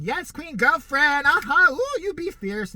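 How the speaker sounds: put-on high, loud, sassy girlfriend voice; hammed-up fierce diva, loud and high; sassy queen impression. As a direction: camp it up like an over-the-top sassy friend. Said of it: "and he's one of those".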